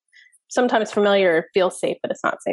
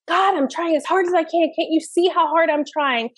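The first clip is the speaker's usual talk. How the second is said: pleading inner voice; 7 semitones higher than usual. Retold or imitated. Voicing childhood inner plea.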